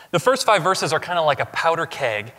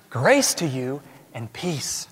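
slow tender reading; slower than usual. Real, not acted. Quoting Paul's greeting slowly.

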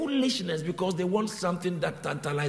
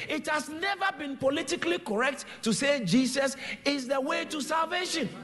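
preaching; 6 semitones higher than usual. Acted. Preaching at full force.

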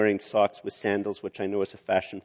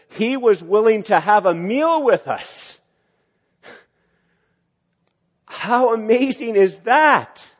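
much higher and louder, slow and marvelling; amazed joy; 10 semitones higher, 8 dB louder, slower than usual. Pretend. Disciples telling of Jesus eating with them, amazed and joyful. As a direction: burst out in wonder at the meal.

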